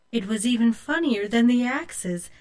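usual speaking voice

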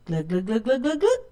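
comic glugging, rising in pitch; gulping noises. A narrator's reading. Old woman drinks thirstily, noisy gulping. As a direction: imitate water being gulped down. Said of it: "gulped the water down".

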